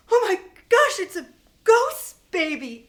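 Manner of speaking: loud, high-pitched alarmed gasp of words; horrified shock. Acted. Kevin looking up at the ceiling, horrified. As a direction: cry out the awful discovery.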